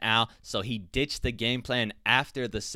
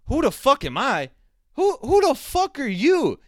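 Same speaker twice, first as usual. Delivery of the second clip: indignant shout; 13 semitones higher, 7 dB louder than usual. Retold or imitated. Fighter roaring back at the press, loud mock yell. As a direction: roar it back, offended and furious. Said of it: "And Raging Al goes".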